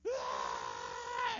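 loud mock wail of a child; imitated bawling. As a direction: burst out crying loudly, hammed-up. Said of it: "He started breaking out in".

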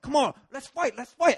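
high, worked-up goading; fight challenge. Retold or imitated. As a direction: egg the opponent on.

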